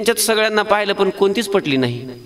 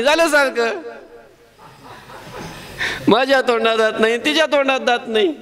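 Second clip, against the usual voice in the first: put-on higher, delighted little boy, rising to the end; toothless child Krishna; 6 semitones higher than usual. Krishna showing Balram that neither he nor she has teeth, higher and gleeful. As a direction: show off the missing teeth with childish glee.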